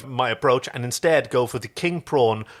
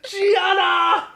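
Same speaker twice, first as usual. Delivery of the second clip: high, loud exclaiming voice; dramatic high cry; 4 dB louder than usual. Acted. Chiana crying out after spilling the secret, high and loud. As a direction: wail it out high.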